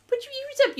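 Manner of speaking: very high pet-talk voice; high, sing-song coaxing of a dog. Real, not acted. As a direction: coo it high to a puppy. Talking to the dog.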